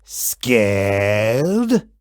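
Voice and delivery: deep rumble, drawn out; very low, slow monster voice. A narrator's reading. Gabe slowly asks the kid if he was scared, deep and drawn out. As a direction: stretch scared like a lurking beast.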